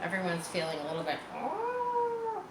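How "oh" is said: high, drawn sigh; a high, let-down oh; deflated oh. Acted. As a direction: let the air out. Deflated class feeling.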